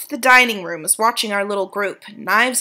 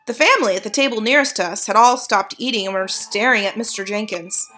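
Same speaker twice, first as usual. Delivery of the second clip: usual speaking voice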